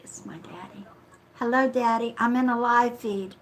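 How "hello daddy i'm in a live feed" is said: louder, calling into the phone; loud phone greeting. Real, not acted. Answering her father's late-night call, loud and raised.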